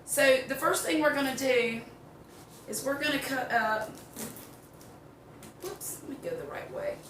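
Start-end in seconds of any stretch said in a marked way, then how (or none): none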